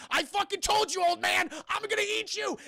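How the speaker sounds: high, loud monster outburst; hammed-up furious threat; angry threat. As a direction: shout it as a fed-up blob on the attack. Acting angry blob's threat.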